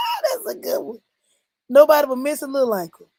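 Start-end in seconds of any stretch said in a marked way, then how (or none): 0.1-1.0 high, squeaky laugh through the words; tickled, pitch way up. Real, not acted. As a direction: talk through real laughter. Laughing at a viewer's joke.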